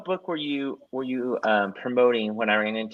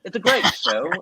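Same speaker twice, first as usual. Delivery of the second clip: put-on British accent; 9 semitones higher, 4 dB louder than usual. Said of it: "in a British accent".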